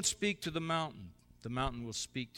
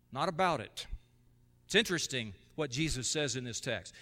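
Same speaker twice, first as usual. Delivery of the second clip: usual speaking voice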